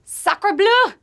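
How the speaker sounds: hammy French cry of surprise; French accent. Pretend. French exclamation for Paris answer.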